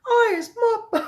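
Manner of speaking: very high, loud giant's screech. A narrator's reading. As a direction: screech it until the voice gives out. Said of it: "screeched the butcher boy".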